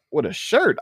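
"With a shirt?" high, rising as a question; incredulous echo. Retold or imitated. Sceptic repeats gym guy's answer "With a shirt?", incredulous.

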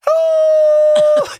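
high, loud drawn-out howl. Pretend. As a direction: howl like a dog. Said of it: "start going".

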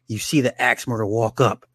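ordinary delivery

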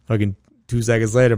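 normal talking voice

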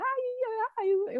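put-on very high, small child's voice; little child voice. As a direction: squeak it out like a little kid. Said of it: "like, little children".

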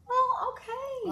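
high, drawn-out "well, okay"; mock-offended concession. Real, not acted. Cohost answers being shot down, mock-offended and high. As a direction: back off in a squeaky huff.

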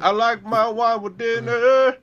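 slow, high snooty voice; mock wine snob. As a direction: play the prissy wine lover. Mock posh wine drinker.

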